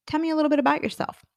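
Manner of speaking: high, fast interviewer voice; interviewer's quick high question; mimicking the interviewer. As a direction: rattle it off high like her.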